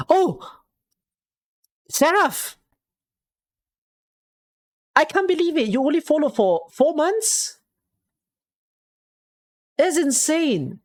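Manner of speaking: high-pitched disbelief; amazed. Real, not acted. Streamer learning Seraph followed only four months, amazed. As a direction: exclaim high, stretching the last words in astonishment.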